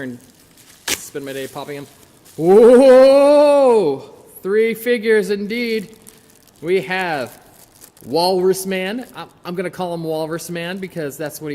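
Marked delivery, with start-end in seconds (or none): 2.4-9.1 much louder and higher, a drawn-out showman's announcement; excited reveal. Real, not acted. Host unboxing the package, thrilled to find three figures. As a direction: unveil the prize like a game-show host.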